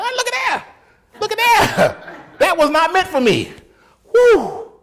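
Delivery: very high, loud mock-amazed cry; amazed relief. Man watching the dog run past him, acted amazed relief. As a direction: squeal with hammed-up relief.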